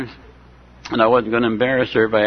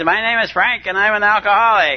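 high, hearty announcing of his name; imitating a loud cheerful man shouting his introduction; mock loud yell; 7 semitones higher than usual. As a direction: boom it out like an overeager newcomer. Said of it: "yelling at the top of his voice".

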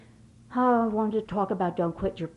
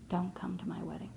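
hushed; 10 dB quieter than usual. Retold or imitated. Daughter's final blow, retold.

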